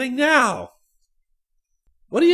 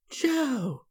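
gasping cry; 2 semitones higher, 4 dB quieter than usual. A narrator's reading. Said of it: "a kind of gasping cry".

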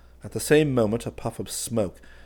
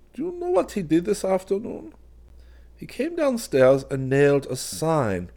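put-on higher, prying old-man lilt; a hint of a fussy old craftsman; lightly voiced old man; 7 semitones higher than usual. A narrator's reading. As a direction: needle him gently as an old friend. Hawberk tells of the sign.

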